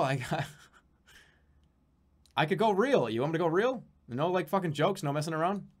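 usual speaking voice

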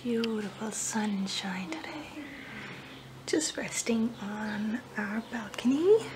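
soft breathy whisper on the balcony. Real, not acted. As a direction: whisper it, relaxed and slow.

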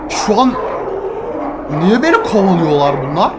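loud panicked cries; being chased. Real, not acted. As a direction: yell while running away. Mummies chasing him.

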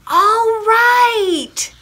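high, loud, drawn-out giving in; exasperated shout. A narrator's reading. Farmer pestered out of bed, shouting exasperated. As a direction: holler it, fed up with the racket. Farmer finally gives in to racket.

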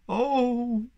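loud, high, drawn-out moan. A narrator's reading. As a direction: groan it out in woe. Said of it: "he moaned".

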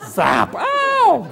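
high, loud cartoon zap and yelp; hammed-up cry of comic pain; mock zapped yelp. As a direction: yelp as if just shocked. Toddler shocked by electrified pad.

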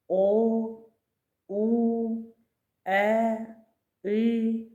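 each vowel sung out long and clear; vowel sounds drawn out. Shown as a demonstration. Teacher modelling vowels to young pupils, drawn out. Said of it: "А".

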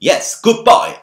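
loud, sharp bark; sharp, forbidding tone. Shown as a demonstration. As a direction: bark it like a stern prohibition. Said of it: "날카롭고 어떤 금지하는 톤으로 얘기되어졌습니다".

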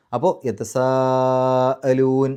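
യതസാഅലൂൻ with the സാ held very long, slow recitation; long vowel stretch. Shown as a demonstration. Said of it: "നാലോ അഞ്ചോ അക്ഷരങ്ങളുടെ ദൈർഘ്യം നിർബന്ധമായും നീട്ടണം".

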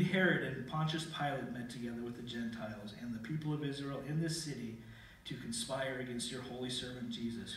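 normal talking voice

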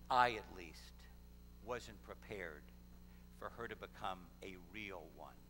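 low, hushed voice of a moved father; retold tearful confession. Father admits to his wife why he's crying, subdued confession. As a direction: confess it softly and low, halting between phrases. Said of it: "that's not why I'm crying".

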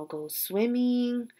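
ordinary talk

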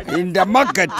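ordinary talk, nothing marked

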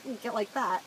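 nasal New York accent demo; nasally accent. Shown as a demonstration. Podcaster showing her accent voice, nasally. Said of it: "I get nasally when I do the".

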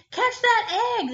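high, loud urgent cry; cow crying out. Acted. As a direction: call out in a panic. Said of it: "the cow cried out".

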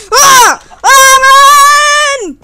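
loud high cry to run; panicked yell. Real, not acted. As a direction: yell in sudden alarm. Sudden danger, yelling to flee.

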